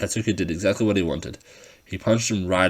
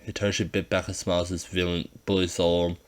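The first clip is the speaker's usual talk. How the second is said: ordinary delivery, nothing marked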